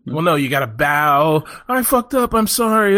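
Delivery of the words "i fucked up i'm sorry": raised, pleading mock apology; a bowing employee begs forgiveness, lightly hammed-up. Employee bowing to the bosses, hammed-up pleading apology. Acted apology before the boss.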